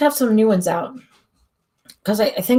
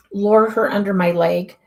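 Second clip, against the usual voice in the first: normal talking voice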